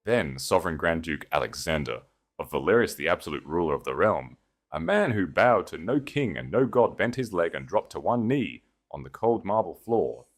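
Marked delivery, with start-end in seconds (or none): none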